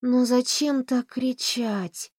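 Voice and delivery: slow, drowsy complaining; sleepy grumble. Acted. Mary Lou grumbles from the sofa, sleepy and slow. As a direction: grumble half asleep, very slowly. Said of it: "сонно проворчала Мэри Лу".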